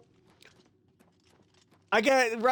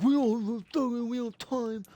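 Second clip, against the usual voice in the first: mocking imitation; 3 semitones higher than usual. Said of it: "he's pissing me off".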